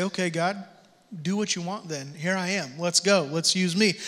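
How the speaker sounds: soft, humble prayer to God; hushed, sincere yielding prayer; quiet surrender. Pretend. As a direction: hand it over quietly and sincerely.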